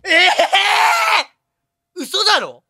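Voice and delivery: loud, high drawn-out cry of shock; real stunned outburst; shocked disbelief. Real, not acted. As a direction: shout in astonishment, stretching the first sound. Shocked by the reveal.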